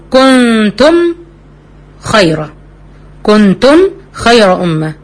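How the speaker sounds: slow recitation with every letter clear; Quran recitation. Shown as a demonstration. Teacher reciting examples of clear pronunciation, slow and careful. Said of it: "ديت في الكلمتين".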